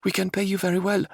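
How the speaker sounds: urgent princess voice. Acted. Princess insists on passage to Lodore, urgent. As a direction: insist like a determined young royal.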